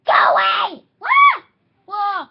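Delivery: loud panicked yells; play shouting. Pretend.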